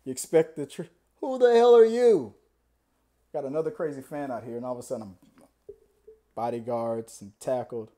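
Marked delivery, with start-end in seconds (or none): 1.2-2.3 high, loud demand; mock indignant challenge. Startled star confronting a fan at the door, indignant. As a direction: challenge a stranger with hammed-up outrage.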